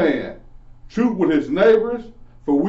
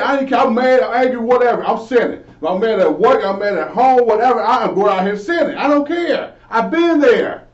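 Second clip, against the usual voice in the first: put-on old angry self; 6 semitones higher, 5 dB louder than usual. Acting out his old angry self.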